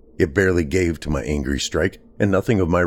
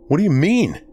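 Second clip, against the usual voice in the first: high, strained and panicky, a touch louder; fearful strained question; 11 semitones higher, 4 dB louder than usual. A narrator's reading. Trapped in the dark barn, panicking at Mark's words. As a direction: voice pulled tight by fear and confusion. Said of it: "strained as it was by fear and confusion".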